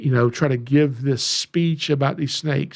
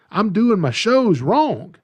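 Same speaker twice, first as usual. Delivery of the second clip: excited; 6 semitones higher, 4 dB louder, slower than usual. Retold or imitated. Retold sudden realization.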